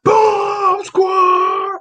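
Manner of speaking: high crowd-chant shout; hammed-up fan yell. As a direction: shout it like fans in the arena. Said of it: "They yelling".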